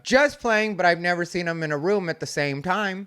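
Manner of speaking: ordinary talk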